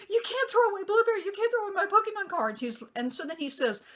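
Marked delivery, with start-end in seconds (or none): 0.1-2.6 put-on high, loud tearful wail of a young girl; crying child. Daughter begging her dad not to throw her things away, crying. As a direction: voice her crying in disbelief, much higher and louder. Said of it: "she immediately starts crying".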